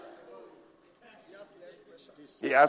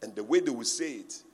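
normal talking voice

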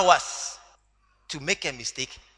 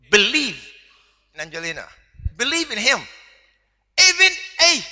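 normal talking voice

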